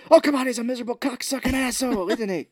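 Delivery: loud, high cursing; hammed-up furious goading; mock exasperated rant. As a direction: push him with an angry outburst.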